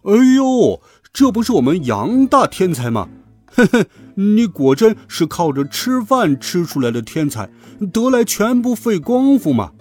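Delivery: high, exaggerated sneering tease; mock him with fake admiration and a little chuckle; barbed mockery. A narrator's reading. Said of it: "夹枪带棒地讽刺我".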